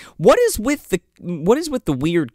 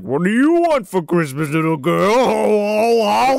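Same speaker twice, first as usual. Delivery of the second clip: a hint of a whimpering, strained Santa; lightly voiced weepy Santa echoing the line; creepy Santa echo; 3 semitones higher, 3 dB louder than usual. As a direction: repeat the line with a crying quaver. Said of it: "And he's like".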